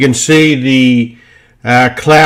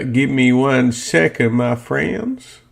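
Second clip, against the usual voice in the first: slow, softer mock American accent; 7 dB quieter, slower than usual. Trader stalling his viewers while he searches, in a put-on American accent. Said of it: "That's my American accent".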